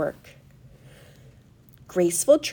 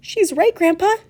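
put-on high, loud boy's exclamation; eager, raised voice; excited cry; 10 semitones higher, 6 dB louder than usual. A narrator's reading. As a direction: call out excitedly to Grandpa. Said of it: "cried Charlie".

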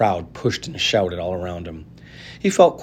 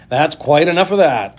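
higher and loud, firm; shouted; 6 semitones higher, 6 dB louder than usual. A narrator's reading. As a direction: shut the crowd up. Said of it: "the fat shopkeeper shouted".

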